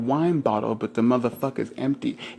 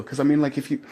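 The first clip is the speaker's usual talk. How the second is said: ordinary delivery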